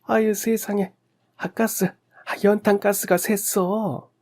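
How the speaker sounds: high-pitched alarmed exclamation; panicked cry. A narrator's reading. Neighbour discovers the gas leak, high panicked cry. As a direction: cry out in horror, pitched high, last words stretched. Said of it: "사색이 돼서 외치셨습니다".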